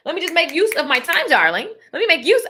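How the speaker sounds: ordinary talk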